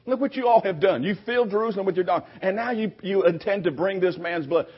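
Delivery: high, fast mock complaint; complaining accusers. Preacher mimics the accusing leaders' complaining whine. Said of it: "Now they're kind of complaining".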